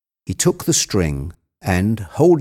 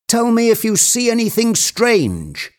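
very high, eager order; excited cry; 14 semitones higher than usual. A narrator's reading. Legrand asking Jupiter what he sees, excitedly. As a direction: press for news at a high pitch. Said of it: "cried Legrand in an excited voice".

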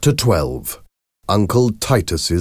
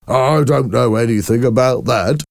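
mocking, higher-pitched imitation; imitating Mister Hoven; 7 semitones higher, 4 dB louder than usual. A narrator's reading. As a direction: mimic the man's denial to make friends laugh. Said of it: "started to imitate him".

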